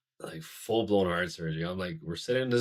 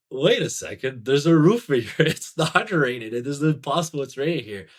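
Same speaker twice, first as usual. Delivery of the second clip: loud, higher incredulous protest; retold disbelief; 7 semitones higher, 10 dB louder than usual. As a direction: relive it with raised, baffled disbelief. Retelling his disbelief during the ceremony.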